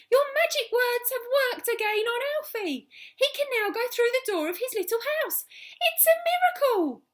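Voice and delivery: high, loud, thrilled calling; overjoyed. Acted. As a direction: shout the good news upward. Overjoyed Mrs Silver calling up.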